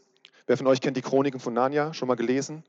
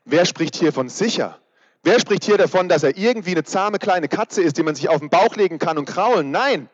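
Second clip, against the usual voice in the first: louder and higher, building to a sharp "Nein"; rising rhetorical outcry; 4 semitones higher, 4 dB louder than usual. Real, not acted. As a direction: challenge the congregation, getting heated. Prediger steigert sich rhetorisch. (immer lauter und höher, empört fragend)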